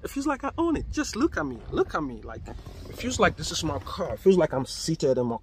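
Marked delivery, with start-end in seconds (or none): none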